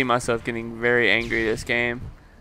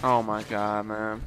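ordinary talk

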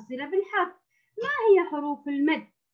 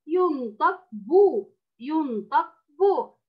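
slow ينطق بو with damma; slow careful demo; 3 semitones higher, slower than usual. Shown as a demonstration. Teacher drills the damma sound for Ola, slowly. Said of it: "حركته الضم".

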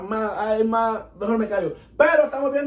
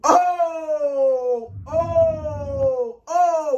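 excited outburst; 6 semitones higher, much slower than usual. Real, not acted. Excited reaction after the song ends.

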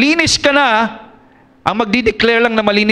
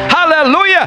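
excited exclamation; 7 semitones higher than usual. Real, not acted. Preacher exclaims in praise.

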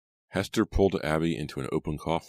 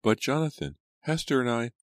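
put-on high-pitched child's protest; protesting little girl; 9 semitones higher, 4 dB louder than usual. A narrator's reading. Abigail protests to her brother, high and insistent. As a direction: plead in a raised little-girl voice.

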